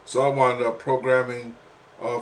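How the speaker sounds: ordinary talk, nothing marked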